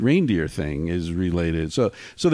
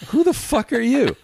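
much higher, indignant disbelief; mock outraged retort, pitched way up; retold outrage; 13 semitones higher than usual. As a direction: fire back at a stranger, shocked and offended. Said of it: "And I'm like".

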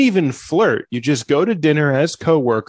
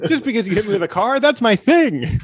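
laughing mock protest; 9 semitones higher than usual. Deer keeps protesting, laughing.

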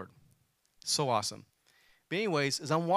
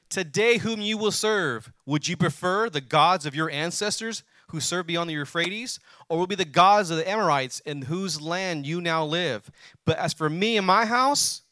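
loud, slow, rising scripture proclamation; full-force preaching; 3 semitones higher, 6 dB louder, slower than usual. Acted. Pastor reading Joshua to the congregation, preaching hard. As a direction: thunder the verse out to the congregation.

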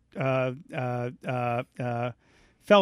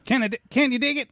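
Dana Carvey catchphrase; 9 semitones higher, faster than usual. Retold or imitated. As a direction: pitch it up like a sketch bit. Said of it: "that was Dana Carvey".

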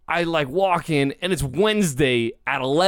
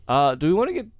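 high voice; 12 semitones higher than usual. Pretend. High far put-on voice.

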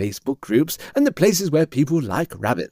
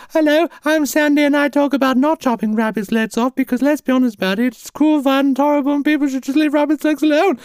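high, piping campaigner voice; cartoonish cover-star declaration, held high; Sandy the influencer. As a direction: preach earnestly in a pitched-up voice. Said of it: "she's on the Daily Mail".

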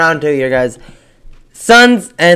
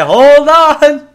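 stunned play-by-play cry, high and loud; drawn-out hyped exclamation; commentator; 10 semitones higher, 5 dB louder than usual. Retold or imitated. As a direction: imitate a commentator erupting at a dunk. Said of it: "the commentator was like".

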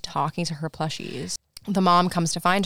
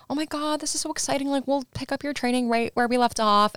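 high-pitched mock gush; excited gushing; 8 semitones higher than usual. Coaches welcoming Caitlyn home, excited gushing.

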